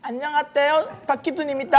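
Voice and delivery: high lisped cute greeting; lisping baby talk. Pretend. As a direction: say it with a childish lisp. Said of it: "박희순 씨 한번 해보세요".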